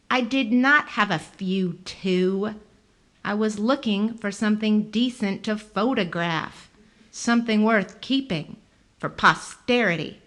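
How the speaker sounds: loud, indignant protest; indignant man. A narrator's reading. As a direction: deny it, offended. Thurman protesting loudly.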